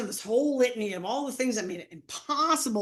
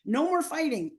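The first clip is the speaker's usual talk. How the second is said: high, bright excited quote with a laugh; excited hope; 10 semitones higher than usual. Retold or imitated. Teacher excited about her candy bar plan, high and laughing. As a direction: sound thrilled at the plan. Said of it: "I'm so excited".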